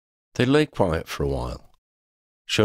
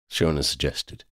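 plain reading voice

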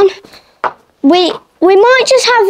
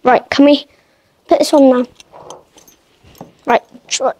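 ordinary delivery

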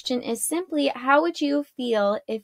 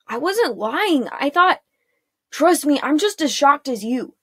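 mildly flustered, higher and insistent; raised, emphatic self-defence; defensive protest; 4 semitones higher, 4 dB louder than usual. Acted. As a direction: plead your case, as shocked as they are. Mavic defends himself against the accusation.

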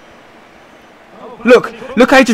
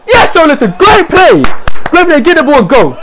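high, urgent praise; thrilled match-day yelling; excited cheering; 7 semitones higher, 3 dB louder than usual. Real, not acted. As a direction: cheer the player on. Cheering a good play.

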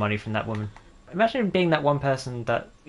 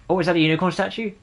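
quick, higher-pitched excited question; too excited; 6 semitones higher, 3 dB louder, faster than usual. Real, not acted. Gamer spotting a unicorn statue, way too excited. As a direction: get carried away spotting something. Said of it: "I sounded way too excited".

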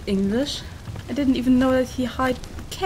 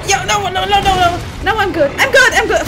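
loud, high, frantic no's; panicked shouting; 6 semitones higher, 7 dB louder than usual. Real, not acted. Gamer yelling at the screen in panic. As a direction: yell it as the enemy closes in. Panicking mid-fight in game.

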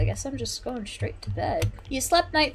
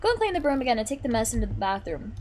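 usual speaking voice

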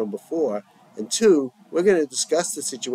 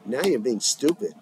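ordinary delivery

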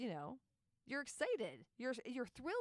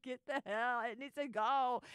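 louder, hammed-up fed-up push; mock exasperated outburst; 3 semitones higher, 7 dB louder than usual. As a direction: play the impatient mother-to-be, half joking. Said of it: "You're just like".

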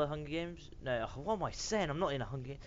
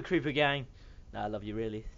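ordinary talk

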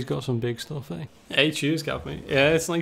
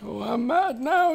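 high, slow mock whine; mock mad; 9 semitones higher, slower than usual. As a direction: pitch it up high and stretch it out in fake anger. Streamer mocks losing the game.

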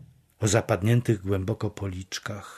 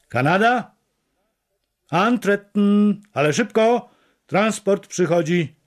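high, raised command to a work detail; called-out order; 8 semitones higher, 4 dB louder than usual. A narrator's reading. Block chief summoning men for the transport, called-out order. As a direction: bark out the order, high and pressing.

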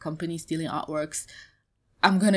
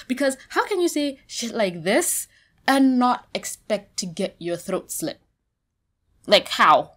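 higher, louder, incredulous; outraged; 4 semitones higher, 6 dB louder than usual. Real, not acted. Vlogger condemns harassers' comments, loud and outraged. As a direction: demand an answer.

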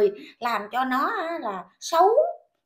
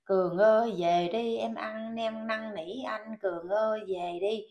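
much lower, softer sarcastic mimic; mocking whiny plea; 4 semitones lower, 6 dB quieter, slower than usual. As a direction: drag out her begging mockingly. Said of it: "cái ứa gan". (giọng nhại năn nỉ mỉa mai)

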